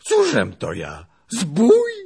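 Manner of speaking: rising to a high, loud cry; frightened protest. Acted. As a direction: protest innocence in alarm. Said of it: "wołał przestraszony Pacholek". (wystraszony, piskliwy protest)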